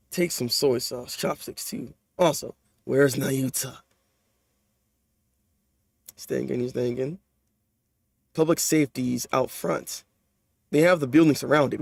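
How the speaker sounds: understated cool voice telling bad news; low hushed slow reading; calm manga character. Reads character dialogue.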